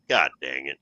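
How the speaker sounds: low, soft self-directed grumble; annoyed at himself. Retold or imitated. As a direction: mutter it after realizing the camera's off. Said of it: "I was like".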